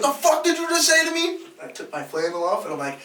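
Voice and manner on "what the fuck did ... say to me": high, fast outraged retort; mock angry challenge. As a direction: imitate an offended man squaring up. Retold angry challenge in a bar fight.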